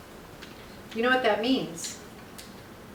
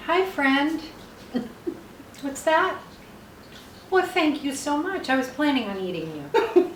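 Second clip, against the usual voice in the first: higher sweet talk to a lobster; high pet voice; 6 semitones higher, slower than usual. Pretend. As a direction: coo at the food playfully. Talking to the lobster.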